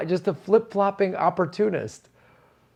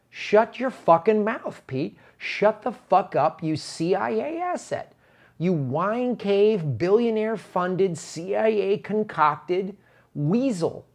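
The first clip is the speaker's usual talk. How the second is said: higher, slow, stretched-out furious insults; angry rant; 5 semitones higher, slower than usual. Real, not acted. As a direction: spit each insult slowly at Pete. Ranting at Pete.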